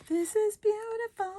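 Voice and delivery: very high sing-song coo; high-pitched gushing. Real, not acted. Crafter repeating praise of the paper, high-pitched gushing. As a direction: gush over it in a tiny high voice.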